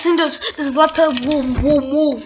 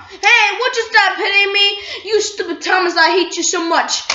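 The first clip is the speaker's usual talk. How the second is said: higher, louder mock outrage; kid-play scolding, yelled and pitched up; pretend angry toy; 6 semitones higher, 6 dB louder than usual. One toy yells at Thomas for hitting him. Toy quarrel, being hit.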